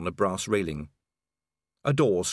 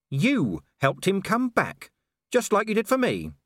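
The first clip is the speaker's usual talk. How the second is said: Vimes accusing; 11 semitones higher, 3 dB louder than usual. Acted. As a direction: throw it at her, building.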